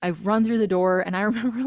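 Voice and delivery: ordinary talk, nothing marked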